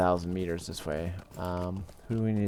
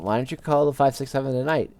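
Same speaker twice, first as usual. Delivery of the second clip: lightly voiced little boy, higher and louder; 6 semitones higher, 5 dB louder than usual. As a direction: imitate a boy calling from the background. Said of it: "little boy in the background".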